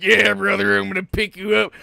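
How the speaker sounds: mock hearty reassurance. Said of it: "you're like".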